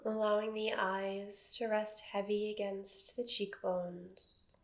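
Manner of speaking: calm, even meditation voice with long silences; guided meditation. Acted. Teacher settling a seated class into body awareness, guided meditation. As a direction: guide them gently into stillness, phrase by phrase.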